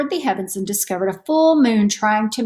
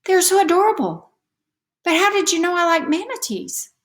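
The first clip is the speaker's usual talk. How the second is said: put-on higher, delighted yet puzzled child voice; puzzled girl; 10 semitones higher than usual. A narrator's reading. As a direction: wonder aloud like a young girl. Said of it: "Somewhat puzzled, Manatee Patty replied".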